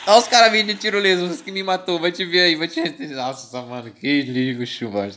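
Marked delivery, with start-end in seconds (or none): 0.1-2.8 excited shout. Real, not acted. Enemies arriving mid-game.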